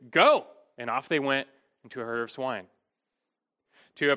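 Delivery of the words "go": loud, high one-word order; commanding. Retold or imitated. Jesus to a legion of demons, commanding. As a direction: punch it out as a command. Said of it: "Jesus commanded".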